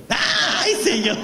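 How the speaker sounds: high startled comic yelp; re-enacted frightened scream. Priest re-enacts his fright at the freezer, high mock scream. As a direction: cry out as if spooked from behind. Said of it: "Grité".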